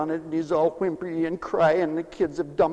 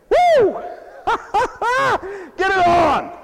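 high, loud whoop; 12 semitones higher, 4 dB louder than usual. Acted. Pastor whooping to show rejoicing, high and loud. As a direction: let out a joyful yell.

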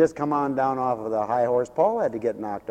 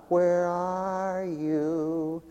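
slow, high, pleading cry; anguished plea to God; 6 semitones higher, 4 dB louder, much slower than usual. Acted. Preacher voicing a soul crying out to God, slow anguished plea. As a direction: stretch it out like a desperate prayer.

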